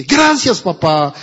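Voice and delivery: ordinary talk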